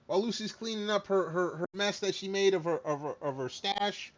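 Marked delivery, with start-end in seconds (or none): none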